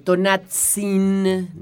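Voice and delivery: Tonatzin spelled out very slowly, syllable by syllable; Tonatzin, each syllable drawn out; slow careful Nahuatl. Shown as a demonstration. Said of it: "muy difícil pronunciar". (Tonatzin muy lento, sílaba por sílaba)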